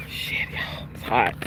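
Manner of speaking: hushed whispered complaint about the heat. Real, not acted. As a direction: breathe it out as a whisper.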